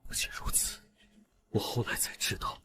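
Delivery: barely audible, whispered lead-in; stricken hush. Acted. Prince starting to reveal his mother's death, very hushed. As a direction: shrink almost to nothing before the revelation.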